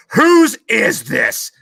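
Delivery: high, loud, slow interrogation; drill instructor bark. Retold or imitated. Drill instructor holding a turd, demanding an owner. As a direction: demand it like a furious drill sergeant.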